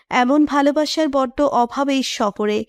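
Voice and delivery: ordinary talk, nothing marked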